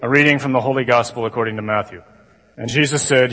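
low, droning liturgical recital; monotone gospel reading. Shown as a demonstration. Imitating a priest reading at Mass, monotone. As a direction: read scripture in a dull monotone. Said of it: "something like this".

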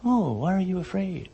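softly spoken, soothing question; gentle mock calm. Shown as a demonstration. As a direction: ask it very softly, as if no storm were raging. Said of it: "He doesn't say".

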